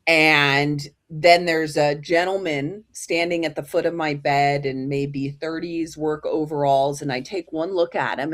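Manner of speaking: usual speaking voice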